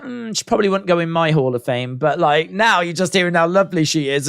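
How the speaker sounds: usual speaking voice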